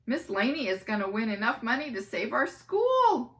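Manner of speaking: put-on a kid shouting with excitement. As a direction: shout it to the whole room. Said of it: "Michael shouted".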